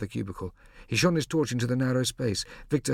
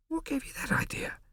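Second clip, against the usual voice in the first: whisper; 4 dB quieter than usual. A narrator's reading. Whispering at night.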